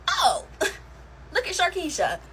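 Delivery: excited recognition. Retold or imitated. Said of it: "and I'm like".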